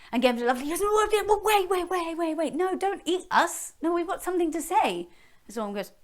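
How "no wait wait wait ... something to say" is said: high, urgent pleading; panicked plea. Acted. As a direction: beg it to stop. Princess pleading with dragon.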